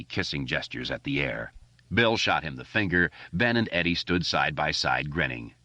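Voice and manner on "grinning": plain reading voice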